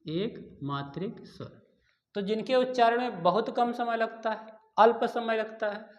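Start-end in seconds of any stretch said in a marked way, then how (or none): 0.0-1.5 slow, soft dictation of एक मात्रिक स्वर. Shown as a demonstration. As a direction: say एक मात्रिक स्वर slowly and softly for students to note. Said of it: "teacher repeats term slowly". (धीमा और धीरे बोला गया)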